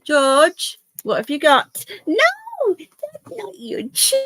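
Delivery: sing-song no-no voice; pet scolding. Real, not acted. Scolding dog gently.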